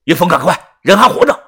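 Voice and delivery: high, loud urgent call. A narrator's reading. Yu calling to Yunfeng that she still lives, loud and urgent. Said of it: "大声地说".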